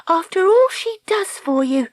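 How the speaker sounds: put-on high-pitched, reproachful girl; Dakota, indignant young heroine. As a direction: scold on, slowing at the end. Voices Dakota reproaching him.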